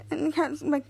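ordinary talk

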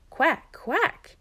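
high, loud answering quack. A narrator's reading. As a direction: quack back strongly. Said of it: "quite a bit louder".

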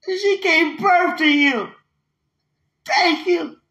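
high, crying, overcome voice; high impassioned wail, close to sobbing; tearful gratitude. Real, not acted. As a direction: cry out thanks from the heart. Overcome, thanking for the birth.

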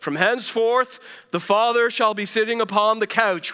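hammed-up town crier announcing from the couch; town crier proclamation. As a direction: proclaim it like a herald in the square. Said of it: "public town square proclamation".

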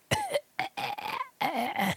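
high, choking mock cough; imitated fit of breathless hacking. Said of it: "I'm out of breath and I'm coughing".

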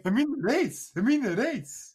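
Rowan Atkinson impression. Quoting Rat Race to friends, in a high put-on voice. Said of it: "It's Rowan Atkinson from fucking Rat Race".

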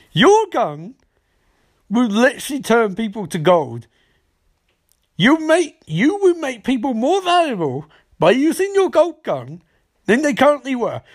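high, emphatic mock disbelief; incredulous rant. Cisco mocking what the gold gun does, incredulous. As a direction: argue it with soaring exasperation.

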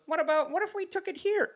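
high-pitched mock producer; meddling producer. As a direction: float a bad idea in a high voice. Producer pitching a weird note.

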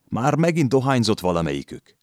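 high, scolding voice of the landlady. A narrator's reading. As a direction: nag at the lodgers.

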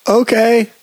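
high, put-on mock okay. Friend imitates the policeman accepting her answer, high mock voice. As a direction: toss it off in a silly high voice. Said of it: "and then he's like".

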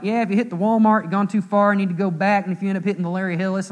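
breezy mock direction-giver, quick at the end; rattled-off directions. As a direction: imitate a local rattling off directions. Retold local giving directions.

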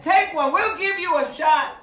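retold party pals pressing an offer; pushy peer pressure. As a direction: egg a friend on. Voices friends pressing him to smoke.

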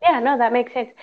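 usual speaking voice

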